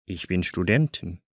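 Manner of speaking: ordinary talk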